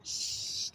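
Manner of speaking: long unvoiced "shh"; hushing hiss, no voice; imitated shush. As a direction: imitate baby sister's drawn-out shush. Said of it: "and she'd go like".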